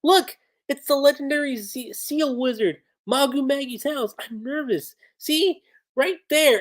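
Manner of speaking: high, breathless fangirl gush; super excited. Retold or imitated. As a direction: gush over a famous wizard's house. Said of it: "Chaco's super excited".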